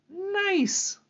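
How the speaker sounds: very high squeal of delight; a playful high-pitched cheer. Real, not acted. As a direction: squeak it out with glee. Pumpkin spice frosting.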